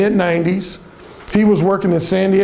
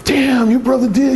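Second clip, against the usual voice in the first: higher-pitched, impressed disbelief; intrigued guys; 7 semitones higher than usual. Retold or imitated. Guys hearing of his brother's exploits, intrigued amazement. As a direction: imitate amazed listeners hungry for more. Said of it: "they get really intrigued".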